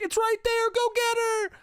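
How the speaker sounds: hammed-up falsetto urging; mock urgent bystander. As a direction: cry out in a comic high voice. Voicing onlookers urging the owner on.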